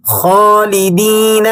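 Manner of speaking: خالدین stretched, a snoring خ at the start; heavy kha demo. Shown as a demonstration. Teacher showing the snoring خ in خالدین, slow. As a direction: rasp the خ in خالدین like a snore. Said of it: "مثال دیکھیں قرآن کریم کی".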